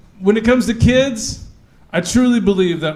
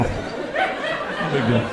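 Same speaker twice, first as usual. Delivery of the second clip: drawn-out shrug; 7 semitones lower, 8 dB quieter, much slower than usual. Retold or imitated. Repeated slowly, trailing laugh.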